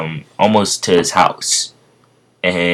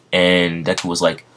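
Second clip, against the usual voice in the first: usual speaking voice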